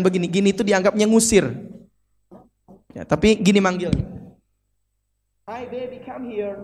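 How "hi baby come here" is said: very soft, higher coaxing call; Westerner beckoning. Shown as a demonstration. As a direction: imitate a Westerner quietly calling someone over. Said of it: "itu orang-orang barat katanya". (menirukan orang Barat memanggil, pelan)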